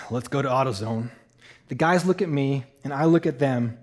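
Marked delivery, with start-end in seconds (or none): 0.1-1.1 low, soft Southern man; deep Southern voice. Retold or imitated. Big stranger proposes a parts run, in deep Southern voice. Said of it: "deep Southern voice".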